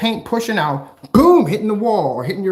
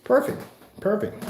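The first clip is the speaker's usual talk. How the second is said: usual speaking voice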